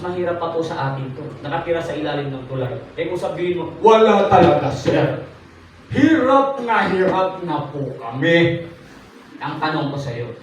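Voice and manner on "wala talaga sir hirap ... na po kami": hammed-up begging plea, slow at the end; mock pleading whine; pleading poor voice. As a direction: act out a struggling complainer. Voicing a poor complainer.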